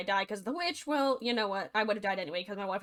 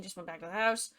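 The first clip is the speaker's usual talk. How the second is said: ordinary delivery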